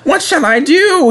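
very high panicked wail. A narrator's reading. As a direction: wail helplessly in a squeaky character voice. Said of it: "he wailed".

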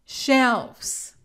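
"Shelves" repeated with a clear v sound; "Shelves" ending in a buzzing v and z; correct plural. Shown as a demonstration. Teacher repeats "Shelves" for learners with a clear v. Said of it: "El plural correcto es".

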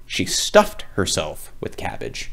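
plain reading voice

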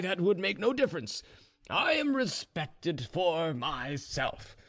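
high-pitched tipsy self-importance; drunk Ivan. A narrator's reading. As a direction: brag drunkenly, dragging out the final words. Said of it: "getting more and more drunk".

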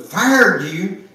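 disbelieving echo of the news; shocked echo. Acted. Mother echoes shocked.

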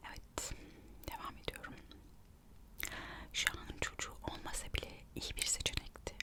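usual speaking voice

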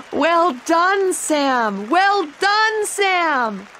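high, loud joyful cries; excited shout. A narrator's reading. Anna cheering Sam after he wins, excited. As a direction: cheer him on with delight. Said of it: "she shouted".

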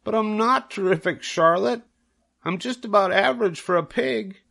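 put-on a shy young pig protesting modestly; bashful pig. Wilbur telling Charlotte he's just average, high and bashful.

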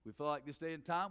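ordinary talk, nothing marked

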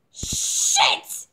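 high, explosive mock curse; pained curse. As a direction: re-enact a burst of swearing in pain. Acts her cry of pain.

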